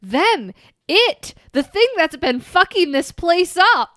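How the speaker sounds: hammed-up angry emphasis, each word punched; exasperated outburst. Daughter blaming the thing in the attic, exasperated. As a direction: act out a loud, high, drawn-out outburst.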